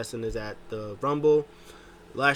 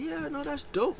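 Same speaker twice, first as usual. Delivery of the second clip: stoned yes-man voice; 3 dB quieter than usual. Retold or imitated. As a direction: play the stoned buddy agreeing, pitched way up. Stoned wrestler agreeing.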